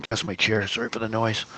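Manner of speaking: ordinary talk, nothing marked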